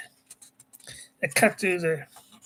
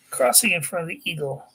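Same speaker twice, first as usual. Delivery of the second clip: normal talking voice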